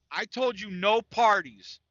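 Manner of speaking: imitating mom laying down the law; mom scolding. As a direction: drag it out like a scolding mother. Mom confronting son about party.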